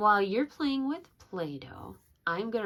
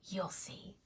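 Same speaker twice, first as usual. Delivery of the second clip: soft secretive whisper; 10 dB quieter than usual. Acted. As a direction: let the raccoon share a secret. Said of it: "Raccoon whispered".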